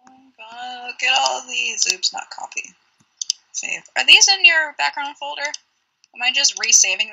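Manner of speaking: ordinary delivery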